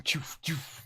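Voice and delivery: soft, puffed whooshes; short airy mouth noises; grenade-throwing sound effect. Acted. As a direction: mimic grenades flying off, quietly. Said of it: "he starts chucking them".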